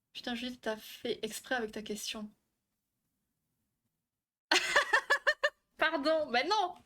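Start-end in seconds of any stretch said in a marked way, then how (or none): 4.5-6.7 high-pitched protest through laughter; real giggly outburst; laughing. Real, not acted. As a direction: laugh while you object. Bursts out laughing at the question.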